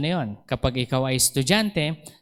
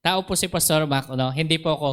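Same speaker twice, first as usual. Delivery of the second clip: usual speaking voice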